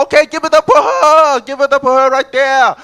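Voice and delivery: high, loud hype announcer; strip club DJ. Acted. Auditioning DJ introducing a dancer to the club, loudly hyped. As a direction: whip up applause at full volume.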